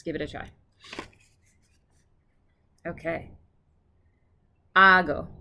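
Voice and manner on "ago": AGO with a loud, long first syllable; Ago, front-stressed and drawn out. Shown as a demonstration. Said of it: "The emphasis is on the first syllable".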